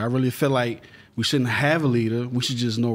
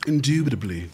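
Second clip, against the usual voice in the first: mock posh English. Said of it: "one of them English terms".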